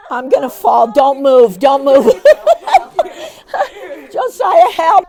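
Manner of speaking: loud playful shouts for help; mock panic yell. Volunteer pretending to fall, calling to Josiah in a loud mock panic. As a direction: holler like you're toppling over.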